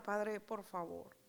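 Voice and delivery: slow, hushed plea to the Father; soft prayer. Retold or imitated. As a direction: murmur it like a morning prayer. Oración suave de la mañana. (súplica lenta y suave al Padre)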